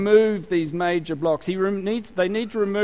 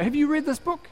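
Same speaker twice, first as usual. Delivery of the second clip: eager question; 10 semitones higher than usual. Retold or imitated. Young self pushing book.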